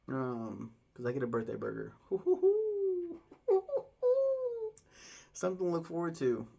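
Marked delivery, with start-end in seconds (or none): 2.0-4.7 high giddy squealing laugh; squeal of laughter. Real, not acted. Excited about his birthday burger, a high squealing laugh.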